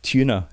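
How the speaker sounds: "Tuna" with a Y glide after the T; yod kept. Shown as a demonstration. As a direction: say "Tuna" as "tyoo-na". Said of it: "that's called yod dropping".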